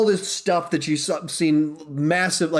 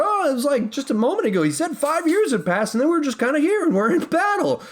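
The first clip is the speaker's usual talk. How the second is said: put-on much higher, eager babbling teen; Peter Parker; 10 semitones higher than usual. Peter telling Tony about battle, high eager teen voice. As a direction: gush like an excited, rambling kid. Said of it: "being very Peter Parker".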